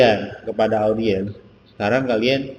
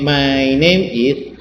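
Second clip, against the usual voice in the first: slow model phrase; 4 semitones higher, 6 dB louder, much slower than usual. Shown as a demonstration. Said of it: "Caranya langsung".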